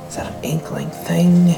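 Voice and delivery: hushed whisper. Real, not acted. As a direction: whisper so nobody overhears. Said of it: "you gotta whisper".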